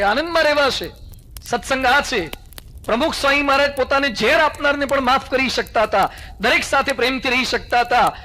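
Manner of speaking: forceful preaching. Acted. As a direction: drive the lesson home like a preacher. Swami preaching to the assembly. (જોશીલું પ્રવચન)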